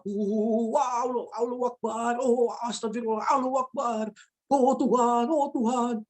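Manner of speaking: high-pitched mock wailing prayer; terrified adults praying. Older passengers praying as plane drops, fearful wailing. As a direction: imitate panicked grown-ups moaning to God. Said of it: "Yang orang tua".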